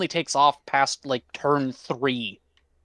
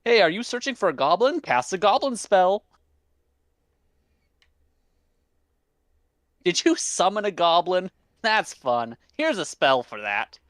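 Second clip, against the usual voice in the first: chirpy higher voice of the goblin deck, eager; personified deck; 5 semitones higher than usual. Retold or imitated. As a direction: play the deck as an overeager salesman offering goblins. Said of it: "and it's just".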